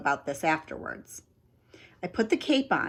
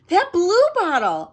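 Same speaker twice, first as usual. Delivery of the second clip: gasping; 11 semitones higher, 7 dB louder than usual. Acted. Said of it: "I gasped".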